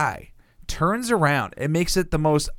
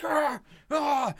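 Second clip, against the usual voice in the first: mock angry yells; 11 semitones higher than usual. Imitating the guy shat on by birds, mock yelps. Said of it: "this guy is just like".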